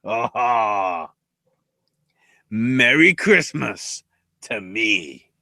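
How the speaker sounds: slow, low self-satisfied creep; low, drawn-out gloat; Noam Dar. Retold or imitated. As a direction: savour it slowly like a creep. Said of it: "Noam's just like".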